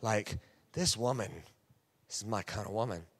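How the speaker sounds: low, soft musing; impressed inner voice. Retold or imitated. Pastor's soft inner voice admiring his date. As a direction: marvel under your breath. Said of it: "And I'm like".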